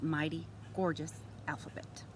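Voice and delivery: ordinary delivery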